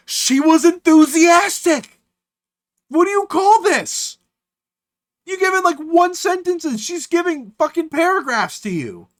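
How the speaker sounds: high-pitched exasperated outburst; worked-up, incredulous high rant; indignant rebuttal. Real, not acted. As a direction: argue back loudly in disbelief. Argues back at the message.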